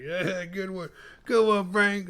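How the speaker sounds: high, hammed-up chuckling praise; mock laughing congratulations. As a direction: congratulate a buddy through put-on laughter. Acting out the laughing pair.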